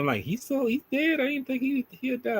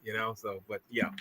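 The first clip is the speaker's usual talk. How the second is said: ordinary talk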